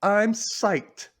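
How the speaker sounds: high, lightly hammed-up thrill; excited. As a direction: act out a girl's excited announcement. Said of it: "gets excited".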